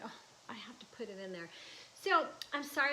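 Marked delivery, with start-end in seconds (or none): 0.0-1.5 breathless awed whisper. Retold or imitated. Remembering reading the poem, whispered. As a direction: whisper it as a sudden certainty. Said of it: "I was like".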